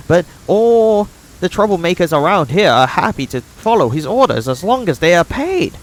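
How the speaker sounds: high, tense voice of a wronged settler; grim villager. Acted. Voices Oleg describing Stag Lord.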